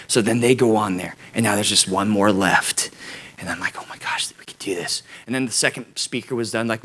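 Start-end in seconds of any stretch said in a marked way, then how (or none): 3.8-5.0 whispered hopeful relief; relieved whisper. Retold or imitated. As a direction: hush it to yourself.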